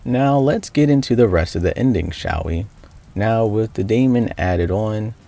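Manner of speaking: steady recap narration voice; story narration. Acted. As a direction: narrate the game's ending evenly.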